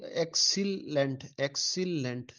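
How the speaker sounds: एक्सीलेंट split as "ek / sil / lent"; syllable division. Shown as a demonstration. Teacher revising with students, एक्सीलेंट in separate syllables. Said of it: "ये इस तरह".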